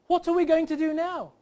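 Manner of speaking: high, loud proper-English accent; perfect English accent. Shown as a demonstration. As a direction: ask it in a fully enunciated English accent. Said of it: "perfect grammatical English".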